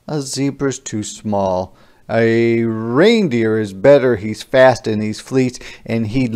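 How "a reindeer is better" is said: ordinary delivery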